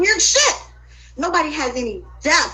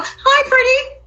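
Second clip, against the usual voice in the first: much higher, cooing fake voice; mock greeting; 11 semitones higher, slower than usual. Imitating fake friends greeting her, high cooing. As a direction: coo it like a phony friend. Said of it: "You sound like her".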